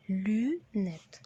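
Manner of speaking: لونات said very slowly, lu-net; slow model pronunciation. Shown as a demonstration. Teacher slowing لونات for the learner. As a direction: stretch out لونات syllable by syllable. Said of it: "بنقولها لونات".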